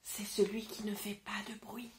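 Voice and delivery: whispering the silent letter; hushed whisper for the silent letter. Shown as a demonstration. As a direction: whisper it like a secret. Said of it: "je ne l'entends pas".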